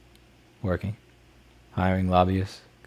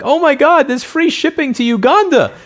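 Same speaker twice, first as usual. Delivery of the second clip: much higher, slow, gushing mock customer voice; clueless customer; 7 dB louder than usual. Streamer mocking a customer who expects shipping, high mock voice. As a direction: play the naive buyer thrilled about a freebie. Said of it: "they think".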